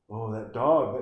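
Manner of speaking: normal talking voice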